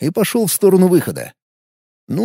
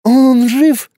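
frightened; 9 semitones higher, 4 dB louder, much slower than usual. A narrator's reading. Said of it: "испуганно произнесла Маша".

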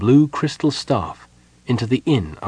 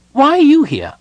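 very high, loud demanding question; suspicious kender; 8 dB louder than usual. A narrator's reading. Tass challenges Parlin outside the door, suspicious. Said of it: "looked up at Parlin with suspicion".

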